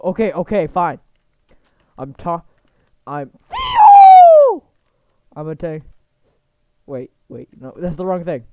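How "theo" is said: very high, loud comic shriek; screamed name. Pretend. Blurts wrong name in parody.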